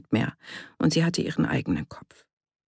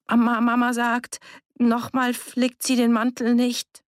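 put-on high, insistent child's voice; stammering child; 8 semitones higher, 4 dB louder than usual. A narrator's reading. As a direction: blurt out an excuse as a cornered child. Cornered child stammers an excuse.

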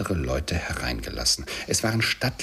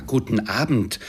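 mildly raised voice, insistent; called-out dismissal; 5 semitones higher, 5 dB louder than usual. A narrator's reading. Scrooge shows his nephew the door, calling out. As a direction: call it out to end the matter. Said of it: "rief Scrooge".

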